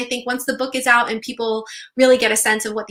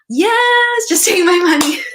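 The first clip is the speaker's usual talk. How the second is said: laughing joke; 8 semitones higher, 6 dB louder, slower than usual. Real, not acted. Laughing joke about merch.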